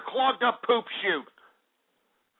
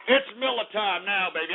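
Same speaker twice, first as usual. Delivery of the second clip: ordinary talk, nothing marked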